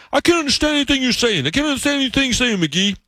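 high-pitched mock complaint; complaining New Englanders. New England coworkers to McGee, high imitated exasperation. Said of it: "these New Englanders I work with".

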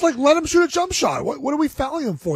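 high-pitched protest at the team; exasperated plea. Retold or imitated. Bettor protesting needless fouls on a jump shooter, exasperated. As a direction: whine upward in disbelief at the fouling.

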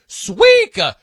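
high, loud burst on the name; hyped nickname shout. Acted. Radio host hyping his guy Patty, loud high shout.

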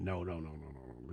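low, trailing run of denials; muttered denial. Retold or imitated. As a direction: let it sink away under your breath, imitating a brush-off. Retelling Scotty's dismissive reply.

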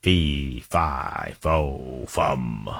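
low, slow, spaced-out giant chant; mock giant. Answering who he hates most, mock giant chant. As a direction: intone it like a lumbering storybook giant.

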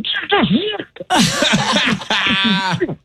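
a high burst of relieved laughter; giddy laugh. Real, not acted. Behind the player's back, he laughs with a high, giddy release. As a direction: let the laugh go once his back is turned. Relieved after the great call.

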